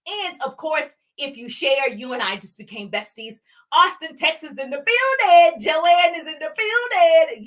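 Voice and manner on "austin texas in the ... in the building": high, loud hype call; excited roll-call holler; shout-out. Real, not acted. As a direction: hail the arrivals like a hype host. Host hails viewers joining live.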